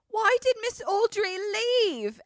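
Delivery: high, loud put-on boy voice; schoolboy's complaint. Boy outside the nurse's office, high loud complaint. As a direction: wail it high like an upset boy. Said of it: "we hear one of them say".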